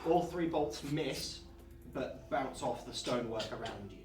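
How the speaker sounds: normal talking voice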